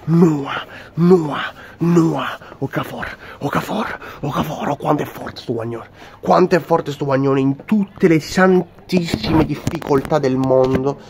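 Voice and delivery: ecstatic shouting fan; breathless italian goal commentary. Acted. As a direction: scream the name in rapture. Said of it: "fan hails Okafor ecstatically".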